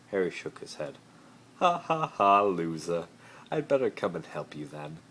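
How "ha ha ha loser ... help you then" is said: mocking laugh. Malfoy laughing at Harry, mocking. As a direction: jeer at him. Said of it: "he said".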